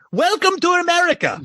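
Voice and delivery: exuberant mock Akeem accent; Akeem's accent from Coming to America. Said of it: "said it like in that accent ... Akeem from Coming to America".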